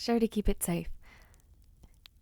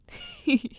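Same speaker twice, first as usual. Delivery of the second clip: light laugh; 5 semitones higher than usual. Acted. Laughs at her own flattery.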